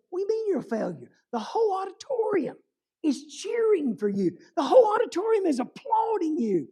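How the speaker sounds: high-pitched, disbelieving friend; incredulous protest. Retold or imitated. Friend contradicting the violinist about the cheering crowd, high and incredulous. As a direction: protest in a high voice, insisting on the praise.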